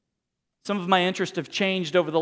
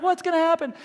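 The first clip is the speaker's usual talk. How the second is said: high-pitched, hammed-up worry; put-on panicky fan voice; mock anxious suspense; 10 semitones higher, faster than usual. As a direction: fret like a fan desperate for the next episode. Mimics himself hooked on TV show.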